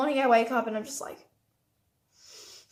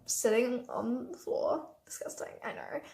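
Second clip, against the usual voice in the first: embarrassed whisper; 9 dB quieter, slower than usual. Real, not acted. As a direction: confess it in a hush. Embarrassed confession aside.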